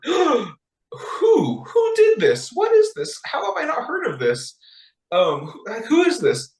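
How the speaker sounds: very high, hammed-up disbelief; shocked king. King hearing of the edict to massacre her people, mock-aghast. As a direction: play a king aghast and demanding answers.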